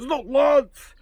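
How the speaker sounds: high, loud mock protest; indignant painter. Painter correcting his nickname, mock indignant. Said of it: "He goes".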